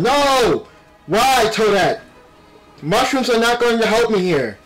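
usual speaking voice

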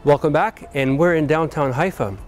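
ordinary talk, nothing marked

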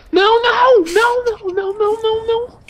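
frantic high-pitched no no no; panicked high cries. Real, not acted. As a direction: panic as you get shot. Dying in game, panicked cries.

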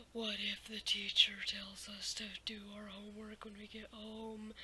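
hushed, breathless whisper of worry; scared whisper. Retold or imitated. Scared middle schooler whispering fears to his friend. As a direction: whisper it like a nervous kid. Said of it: "we were scared little kids".

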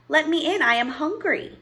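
high-voiced princess calling to be let in; pleading princess. Lost princess knocks a second time, pleading. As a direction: plead loudly at the door.

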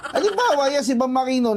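ordinary talk, nothing marked

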